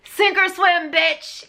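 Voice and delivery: sassy high tough-love taunt. Pretend. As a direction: fling it out like a blunt drill sergeant with attitude. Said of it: "And sometimes we need that".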